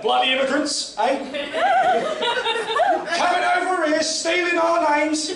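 lightly put-on Cockney, laughing through it; a hint of a Cockney geezer grumbling; mock Cockney bloke. Comic voicing the imagined Cockney bloke, mock Cockney grumble. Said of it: "strolls the streets like".

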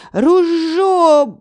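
high, loud gurgling cry; gurgled cry. A narrator's reading. Drowning hunter gurgles a cry for his gun. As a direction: cry out while half underwater. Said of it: "булькнув він".

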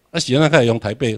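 ordinary delivery

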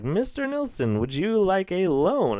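high, slow mock telemarketer pitch; high mock caller voice. Loan company calling to offer a loan, high mock voice. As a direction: squeak it like a pushy loan caller.

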